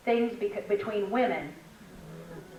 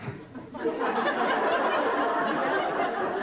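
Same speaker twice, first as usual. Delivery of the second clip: a long breathy laugh, high; burst of laughter; 10 semitones higher than usual. Real, not acted. Laughing at her friend's name for seagulls.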